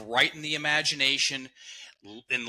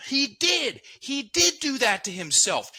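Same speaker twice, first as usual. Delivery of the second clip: much higher and louder, firm "did" falling to a close; sudden conviction; 13 semitones higher, 5 dB louder than usual. Retold or imitated. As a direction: blurt it out as the truth finally lands. Breath unlocked, sudden conviction.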